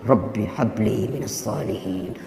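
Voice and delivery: put-on slow whisper into a child's ear; hushed, slow prayer whispered to the nephew. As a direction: breathe the prayer softly into his ear. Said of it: "Saya bisik telinganya".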